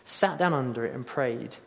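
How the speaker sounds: soft, measured reading aloud; solemn scripture reading. Acted. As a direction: read the passage quietly, letting the ending sink. We read this.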